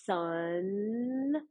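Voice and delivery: "Sunshine" slowly stretched, each sound held; long, lifting "Sunshine"; drawn-out sunshine. Shown as a demonstration. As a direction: join sun and shine, long and slow. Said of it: "we can put it together".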